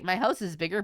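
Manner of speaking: ordinary delivery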